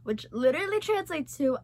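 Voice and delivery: usual speaking voice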